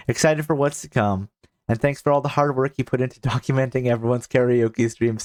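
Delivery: ordinary talk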